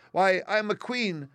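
high, loud, slow squeaky queen; proud mouse queen. A narrator's reading. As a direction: announce it like a tiny proud queen. Mouse queen declares herself.

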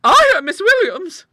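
very high, loud nervous answer; high-pitched fluttery lady; palpitating dread. A narrator's reading. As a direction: answer as if still badly frightened. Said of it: "still palpitating and glancing furtively about in dread".

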